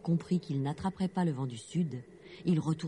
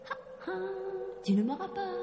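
vent du sud en riant; much faster than usual. A narrator's reading. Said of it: "en riant".

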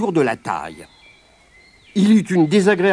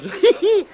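high mocking giggle; monkey cackle; 14 semitones higher than usual. Monkey laughing at Grand Patu, high giggle. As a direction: giggle at him, much higher.

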